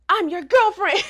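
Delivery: high, loud gotcha voice; spring the twist; mock reveal. Hana revealing herself to Ryusei in a high loud mock voice.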